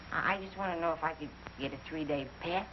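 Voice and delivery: low, soft, hesitant asking; timid request. Acted. Private asks his sergeant for a pass, timid. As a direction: meekly stammer out a request.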